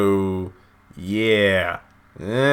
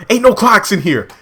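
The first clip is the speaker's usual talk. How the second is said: higher and louder, punchy mock retort; high mock snippy husband; 9 semitones higher, 6 dB louder than usual. Husband brushing off wife's question, high mock snippy retort. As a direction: snap it back up high and loud. Husband deadpans reply to wife.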